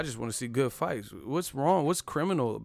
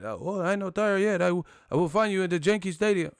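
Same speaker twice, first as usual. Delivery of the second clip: high, louder mock fan threat; mock angry fan; 7 semitones higher, 6 dB louder than usual. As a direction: ham up the fan calling him out. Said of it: "they was really mad".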